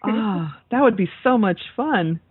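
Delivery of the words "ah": usual speaking voice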